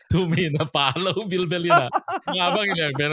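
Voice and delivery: cracking up mid-sentence; laughing while talking. Real, not acted. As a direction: talk through a fit of laughter. Group breaks up laughing mid-talk.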